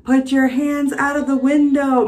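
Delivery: drawn-out mock megaphone order; cop on microphone. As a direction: imitate a cop ordering over a loudspeaker. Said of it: "The cop says on his microphone".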